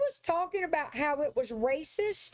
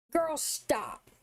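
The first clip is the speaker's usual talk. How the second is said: sassy voice. Acted. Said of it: "I can't when you do that voice".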